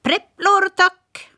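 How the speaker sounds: high, quick parrot voice; chatty parrot. Acted. Parrot introducing herself to Smirke, high bird voice. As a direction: rattle it off like a talkative bird. Polly asks for treats.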